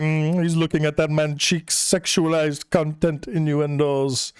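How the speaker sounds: mock Portuguese accent that lands closer to Indian; drawn-out, badly faked Portuguese accent. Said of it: "I don't know what a Portuguese accent sounds like".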